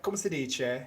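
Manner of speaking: lightly put-on Italian accent; mock Italian accent. Cohost defending his accent to the host, mock Italian. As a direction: play at sounding like a real Italian. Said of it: "That's, that's legit, dude".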